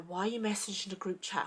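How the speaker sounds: soft, even imitation of a friend; calm, evil. Friend questioning the group chat message, eerily calm. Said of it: "he was just so calm".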